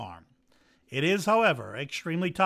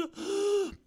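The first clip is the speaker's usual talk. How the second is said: high gasp. Acted. Gasp before the big crow.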